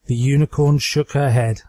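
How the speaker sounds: ordinary delivery, nothing marked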